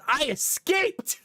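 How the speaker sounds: put-on very high, slow proud toddler voice; a toddler's high, slow "I escaped". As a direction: announce it slowly like a proud little escapee. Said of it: "He goes".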